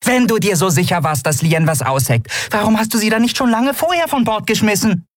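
high-pitched accusing voice; challenging Nelen. Acted. Nelen throwing blame back at Keru, high challenge. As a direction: challenge him in a high, small voice.

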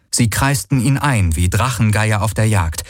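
plain reading voice

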